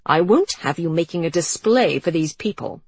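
loud, stern dowager scolding. Acted. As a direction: scold her like a child. Mother scolding niece.

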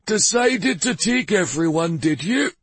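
high, teasing tone; amused, chuckling question. A narrator's reading. King teasing a departing traveller, mildly amused. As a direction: tease him with a touch of amusement. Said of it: "he asked with a chuckle".

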